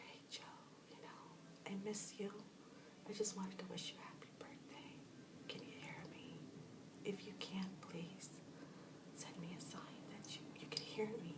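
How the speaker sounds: hushed whisper of birthday wishes; whispering to a friend who passed. Shown as a demonstration. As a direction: whisper gently, hoping he hears. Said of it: "I would whisper".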